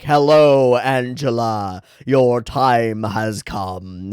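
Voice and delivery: loud hello, then very slow, grave words; Death's voice. Retold or imitated. Death appearing to Angela, slow and grave. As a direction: announce her doom slowly like the Grim Reaper. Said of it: "that's the voice that he uses".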